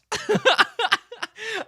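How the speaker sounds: a burst of high delighted laughter; high cackling laugh. Real, not acted. Narrator cackles high at Chris's danger-seeking. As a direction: cackle at the absurdity.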